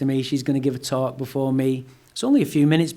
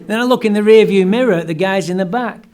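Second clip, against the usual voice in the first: usual speaking voice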